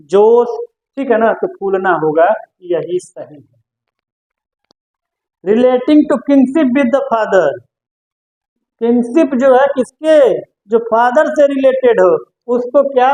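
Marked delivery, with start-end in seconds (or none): 5.4-7.5 reads the English clue very slowly; slow dictation. Shown as a demonstration. Teacher reading the quiz clue to students, very slowly. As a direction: spell the clue out word by word for the class.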